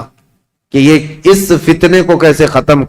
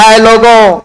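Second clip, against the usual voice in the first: calling out; 6 semitones higher, slower than usual. Retold or imitated. Governor addresses crowd from roof.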